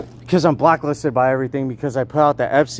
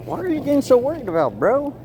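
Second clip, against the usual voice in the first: high, swooping mock puzzlement; mock-puzzled question; 8 semitones higher than usual. Teasing UCLA for getting worried, high mock-puzzled voice. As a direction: pitch it up high, teasing them.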